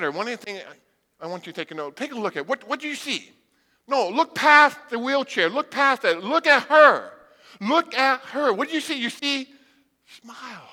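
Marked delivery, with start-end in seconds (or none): 3.9-8.5 emphatic, slow insistence; preacher urging the congregation to see Shirley. Real, not acted. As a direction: press them to look past the chair. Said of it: "urging the congregation".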